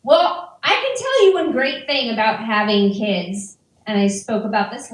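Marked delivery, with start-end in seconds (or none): none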